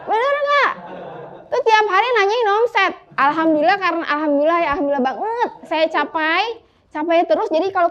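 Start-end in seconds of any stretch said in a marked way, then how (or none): 0.1-2.9 much higher-pitched, teasing call-out; calling out playfully. Real, not acted. Speaker teases Kang Dewo about daily omset questions, high playful call-out.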